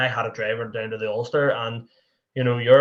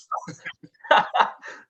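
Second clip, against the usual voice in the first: breathy laugh; 4 dB louder than usual. Real, not acted. Laughing at his own panic.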